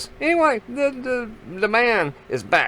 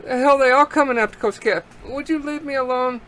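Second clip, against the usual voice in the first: retold panic; 7 semitones higher than usual. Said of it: "and I'm thinking".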